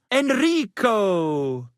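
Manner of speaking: drawn-out Italian-style name with a grand sweep; theatrical flourish. Acted. Bard christening Henry with an Italian name, slow and theatrical. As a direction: savor the name like a stage bard.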